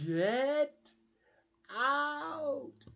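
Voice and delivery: hammed-up cartoon outburst on each word; furious gumption. Plankton gets mad at Mister Krabs, hammed-up yell. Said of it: "He says it with that kind of gumption".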